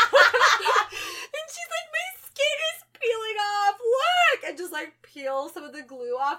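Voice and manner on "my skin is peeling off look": high, loud mock shriek; fake crying panic. Girlfriend showing her peeling skin, fake panicked shriek. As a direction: shriek it in hammed-up distress. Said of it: "fake crying and freaking out".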